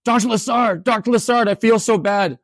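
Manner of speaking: retold student, voiced high; imitating a distressed student in class; upset student. Student apologizing to lecturer.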